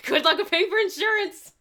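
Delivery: loud, high, breaking into laughter; amused outburst over a laugh; laughing aside. Real, not acted. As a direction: blurt it out while laughing.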